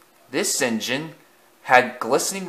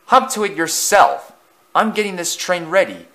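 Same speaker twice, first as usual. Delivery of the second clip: scoffing; 7 semitones higher than usual. Acted. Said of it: "Thomas scoffed".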